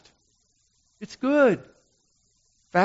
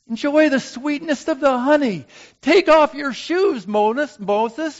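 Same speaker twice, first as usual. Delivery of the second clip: urgent plea; 12 semitones higher, 7 dB louder than usual. Acted. Urging Moses, high and loud.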